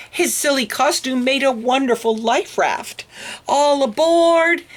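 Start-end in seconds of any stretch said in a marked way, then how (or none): 3.5-4.7 loud, high, slow call. Acted. Oscar calling the dogs onto his raft, loud and high. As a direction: call everyone aboard.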